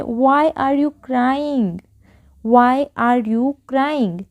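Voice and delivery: very slow, word by word; slow model sentence. Shown as a demonstration. Teacher modelling an English sentence for Hindi learners, very slow. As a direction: say it slowly so learners can follow. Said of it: "तो हम क्या बोलेंगे".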